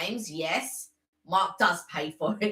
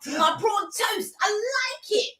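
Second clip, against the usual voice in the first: playful greedy shout; 13 semitones higher, slower than usual. Pretend. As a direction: chant it like a greedy kid. Playful chant about food cravings.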